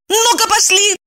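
high-pitched bark; barked order. A narrator's reading. Evdokia, yanking her friend by the arm, barks high. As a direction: bark a command to move. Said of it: "гаркнула".

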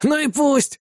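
high, petulant retort; stubborn defiance. A narrator's reading. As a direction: dig in stubbornly. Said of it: "упрямствовал Арви". (упрямо, высоким голосом)

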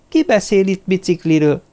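high-pitched, mildly incredulous question; recovering from bafflement. A narrator's reading. Sophie to Lamartine about the bicycle, mildly baffled and high. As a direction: pitch up in puzzled surprise. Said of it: "kérdezte magához térve Sophie".

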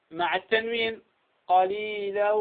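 ordinary talk

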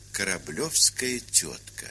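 ordinary talk, nothing marked

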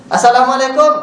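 calling greeting. Pretend. Guest at a relative's door calling out the greeting. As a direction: call the greeting out so the house hears.

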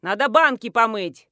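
loud, bossy call; shouted order. A narrator's reading. Mother-in-law demands help with the jars, shouting. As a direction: bark out the chore. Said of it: "закричала из окна соседнего дома тётка".

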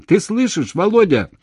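loud, very high panicked question; frightened shout. A narrator's reading. Woman shouting to him down the phone, frightened. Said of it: "Так же испуганно, как он, закричала".

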